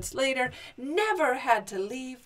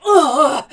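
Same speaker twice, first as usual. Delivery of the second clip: loud heaving grunt; effort groan; 3 semitones higher, 14 dB louder than usual. Acted. Giant turning the house round, groaning with effort. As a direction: let out a big groan of effort.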